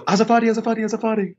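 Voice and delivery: high squeaky mock panic; flustered nun. Nun seeing the naked man, imitated flustered panic. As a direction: imitate a mortified woman apologizing over and over, very high.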